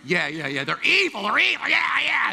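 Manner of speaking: high, loud overdone agreement; mock agreeing. Pastor mocking himself agreeing with a ranting friend. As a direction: gush agreement to placate.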